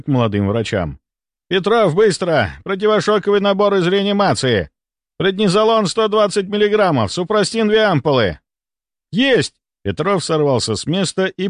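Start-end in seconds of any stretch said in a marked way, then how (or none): 1.5-8.4 high, pressing doctor's commands; urgent shouted orders. A narrator's reading. 9.1-9.5 high, urgent reply to an order; eager acknowledgement. A narrator's reading. Junior answers an urgent order.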